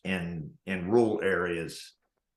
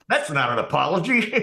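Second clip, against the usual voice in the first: raised, higher retort through a laugh; indignant retort; 7 semitones higher, 5 dB louder than usual. Retold or imitated. Customer rejecting the manager's apology, indignant and laughing. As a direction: throw it back at him, outraged. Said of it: "the guy's like".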